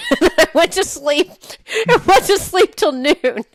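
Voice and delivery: high, loud and laughing. Real, not acted. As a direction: crack up while telling it. Laughing at her own anticlimax.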